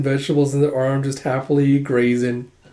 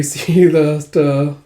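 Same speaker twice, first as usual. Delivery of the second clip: ordinary talk, nothing marked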